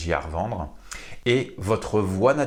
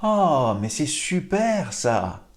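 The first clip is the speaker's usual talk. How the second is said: bright, higher joyful exclaim; joyful demo; 6 semitones higher, slower than usual. Shown as a demonstration. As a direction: sound delighted, stretching it slowly. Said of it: "On va exprimer de la joie". (démonstration de joie, plus aiguë)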